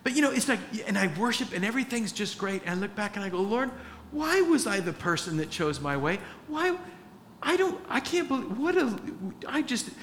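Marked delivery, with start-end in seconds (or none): 3.4-9.9 much higher, incredulous self-reproach; high, bewildered lament; exasperated disbelief. Retold or imitated. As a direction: throw up your hands at yourself. Retold exasperated prayer at himself.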